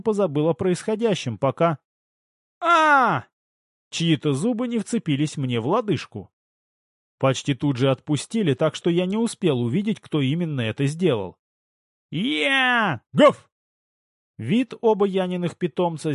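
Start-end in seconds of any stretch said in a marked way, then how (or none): 2.6-3.2 high, loud "ah" of sudden pain; sudden high-pitched cry; startled yelp. A narrator's reading. Bitten on the ankle. 12.1-13.5 high donkey-like cry, then a loud bark; high bray and sharp woof; pet animal noises. A narrator's reading. Pets answer with animal noises.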